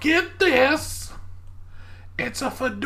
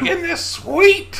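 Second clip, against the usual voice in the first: louder, slow, proud gleeful question; gleeful shopkeeper; 5 semitones higher, 6 dB louder, slower than usual. Acted. Shopkeeper showing off a katana to the party, gleeful. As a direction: show off the blade with delight. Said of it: "She's like".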